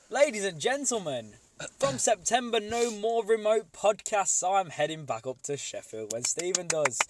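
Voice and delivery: loud, high-pitched mock announcer; announcer proclamation. Podcaster announcing his move back to listeners, announcer proclamation. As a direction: proclaim it big and drawn out like a ringmaster.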